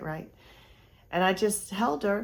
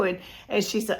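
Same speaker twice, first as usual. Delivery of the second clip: ordinary delivery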